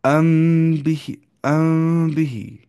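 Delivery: "ام بہ" held very slowly, the م hummed through the nose into ب; nasal ikhfa. Shown as a demonstration. Teacher repeats "ام بہ" so students hear the hidden meem, slow. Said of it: "ایسے ہی".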